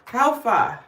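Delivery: punchy, high, loud Nigerian greeting; Nigerian accent. Shown as a demonstration. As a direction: call it out in an Americanized Nigerian accent. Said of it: "My Nigerian accent is, ... very Americanized".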